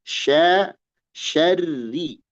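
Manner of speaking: "syarri" with light "sha" and doubled thin r; thin trilled ro. Shown as a demonstration. Teacher models doubled ro, "syarri" thin and trilled. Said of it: "Ro ada tasdid".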